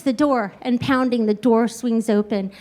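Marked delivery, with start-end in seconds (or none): none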